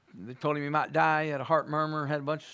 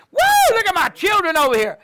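much higher, louder joyful cry of a delighted Father; God delighted; 14 semitones higher, 12 dB louder than usual. Acted. God beaming at his children, a joyful high cry. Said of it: "God looks at you with a smile on his face and says".

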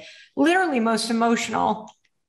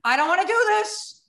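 mock panic; 9 semitones higher than usual. Refusing to jump from the plane, higher panicked pleading. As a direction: beg them not to push you out.

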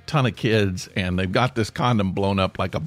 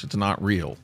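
ordinary talk

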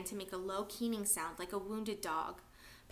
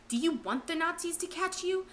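urgent hiss; 6 semitones higher, 5 dB louder than usual. Acted. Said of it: "Joseph hissed at Papa".

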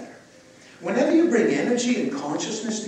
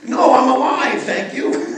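playful shout; 6 semitones higher, 10 dB louder, slower than usual. Real, not acted. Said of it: "laughs after mock scolding".

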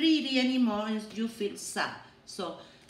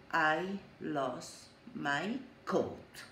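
slow, soft and lower, settled voice; calm statement; 5 semitones lower, 4 dB quieter, much slower than usual. Shown as a demonstration. Teacher modelling a calm problem statement for children. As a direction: breathe out, then say it slowly. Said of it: "And you say the problem".

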